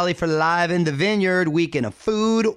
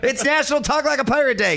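much higher, eager announcement; excited; 10 semitones higher than usual. Real, not acted. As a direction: pitch it up with glee. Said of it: "What is happening?".

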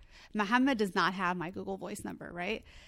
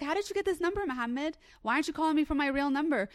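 indignant question; 6 semitones higher, faster than usual. Retold or imitated. Quoting herself confronting Muhammad.

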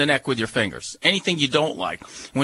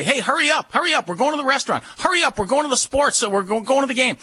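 high, eager hurry-up call, much higher pitch; hurried urging; 9 semitones higher, faster than usual. Retold or imitated. Owner hurrying family out the door, eager and high. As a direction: rush someone out the door to the restaurant. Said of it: "you might say".

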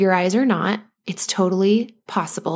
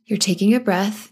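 usual speaking voice

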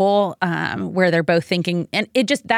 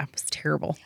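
whispering; 4 semitones lower, 10 dB quieter, faster than usual. Real, not acted. Recalling a murder book.

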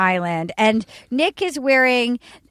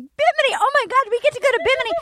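imitating thrilled girls, very high-pitched; mock excited girls; 11 semitones higher, faster than usual. All the girls learn they get to go to Bimini, mock excited squeal. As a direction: squeal it like overexcited contestants.

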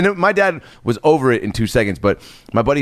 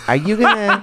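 high, tentative mom voice trailing off; hesitant mom; 8 semitones higher than usual. Retold or imitated. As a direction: ask it like a careful mom, pitched up.

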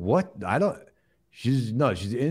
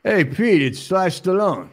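high, raised mock-call greeting; phone greeting; 9 semitones higher, 5 dB louder, slower than usual. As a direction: call it brightly down the line, pitched up. Pretend phone call to Pete.

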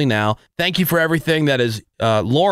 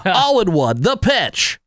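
pukey play-by-play announcer voice; baseball announcer; 5 semitones higher, slower than usual. Acted. Host spoofing how announcers all sound, baseball play-by-play. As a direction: call it like a hammy baseball announcer. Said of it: "next on the list is baseball announcer".

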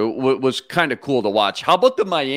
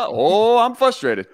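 higher, mock frustrated Kirk; imitating Kirk; 7 semitones higher than usual. Host quoting Kirk on the sideline, mock frustrated. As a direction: play up the grumpy quarterback, higher. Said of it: "Kirk was like".

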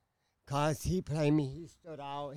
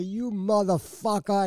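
slow, loud, higher re-enacted swearing; retold furious curse; 6 semitones higher, 5 dB louder, slower than usual. Woman cursing cheating man before cutting him, retold angry curse. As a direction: draw the curse out with force.

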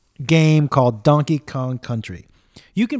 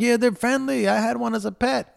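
higher mocking voice of a raccoon lover; mock raccoon fan; 8 semitones higher than usual. Mocking raccoon owners to listeners, in a higher put-on voice. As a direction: parrot the people who defend raccoons. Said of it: "People are like".

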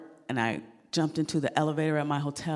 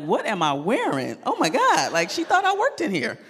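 panicked realization; 7 semitones higher, 5 dB louder than usual. Retold or imitated. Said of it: "I was like".

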